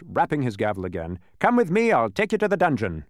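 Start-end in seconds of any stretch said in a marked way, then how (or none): 1.4-3.0 commanding officer. A narrator's reading. Judge orders prisoners along.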